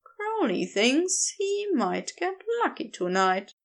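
ordinary delivery

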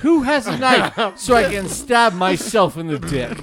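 loud laughing mock despair; mock anguished shout. Cohost shouting in mock horror at the dirty word. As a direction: shout it through laughter, hamming up the suffering.

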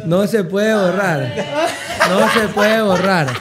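slow teasing. Real, not acted. Teasing that clip stays.

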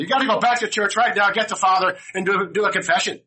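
high, loud, scolding mother voice; bossy mother. Retold or imitated. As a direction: order him back to church as the mother. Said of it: "And his mother says".

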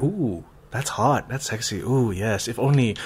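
slow, soft swooning sighs; lightly acted lovestruck fan. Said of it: "characters you're like".

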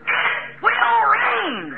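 very slow, drawn-out and high; slow solemn promise. Acted. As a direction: stretch every word with conviction. Noah's slow firm answer.

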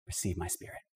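soft surrender. Acted. Stephen's dying words retold.

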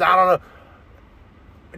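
slow, drawn-out mocking refusal; a lightly voiced ex, sluggish and dismissive. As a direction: drag it out like a sulky ex. Ex flatly refusing, mocked.

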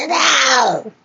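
loud, high, strained shriek; screamed now. Acted. Creature screaming at the stranger for its precious, loud and high. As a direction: explode on the word. Creature's outburst after building threat.

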